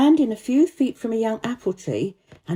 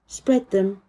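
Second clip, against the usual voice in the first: plain reading voice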